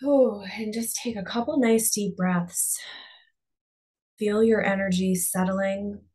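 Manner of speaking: calm, unhurried meditation voice with long pauses; guided meditation. Real, not acted. Coach guiding listeners to close their eyes and breathe, guided meditation. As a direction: ease them inward, leaving space after each phrase.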